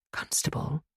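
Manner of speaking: a hushed call to the officer; soft whisper. A narrator's reading. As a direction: whisper it, barely voiced. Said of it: "she said softly".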